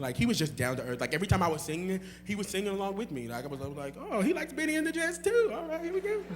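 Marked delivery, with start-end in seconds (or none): 4.0-6.2 high-pitched, excited mock exclamation; delighted reaction. Singer finds a fellow Benny and the Jets fan, excited. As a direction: act out a pleased discovery way up high.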